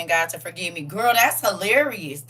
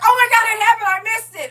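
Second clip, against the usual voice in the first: high, loud imitation of panicked neighbors; mock shock; 13 semitones higher, 7 dB louder than usual. Mocking neighbors who find clothes on the lawn, high mock alarm. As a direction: play the left-behind folks gasping in alarm. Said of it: "They gonna be like".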